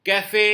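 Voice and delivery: café, final é as a clear ay; French pronunciation. Shown as a demonstration. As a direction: model café loudly for students. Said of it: "teacher models French words".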